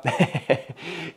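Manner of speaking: quiet, happy little laugh; soft chuckle. Real, not acted. Vlogger delighted by autumn, soft chuckle. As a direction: chuckle softly to yourself.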